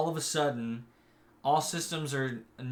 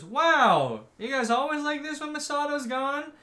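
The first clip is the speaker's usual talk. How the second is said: amazed reaction; 12 semitones higher, 4 dB louder than usual. Retold or imitated. Babysitter finding the kids listless, amazed. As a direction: marvel in a raised, teasing pitch.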